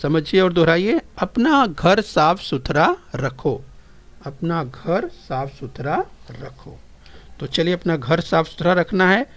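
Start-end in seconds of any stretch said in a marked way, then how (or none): 1.2-3.6 slow model reading. Shown as a demonstration. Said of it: "ध्यान से सुनिए और समझिए और दोहराइए". 4.3-6.8 the same sentence, slow and much quieter; soft, slow classroom echo; softer repeat. Shown as a demonstration. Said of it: "ध्यान से सुनिए और समझिए और दोहराइए".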